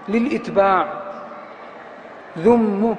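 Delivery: normal talking voice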